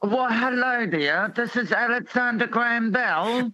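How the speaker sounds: high-pitched put-on English accent; hammed-up proper English accent for a first phone call; Alexander Graham Bell. Caller acting the first phone call for the host, mock English accent. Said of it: "You want me to do an English accent?".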